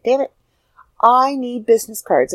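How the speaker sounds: retelling a forehead-slap moment; sudden realization. Retold or imitated. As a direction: realize it all at once, annoyed at yourself.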